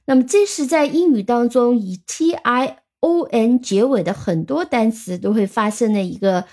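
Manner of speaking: usual speaking voice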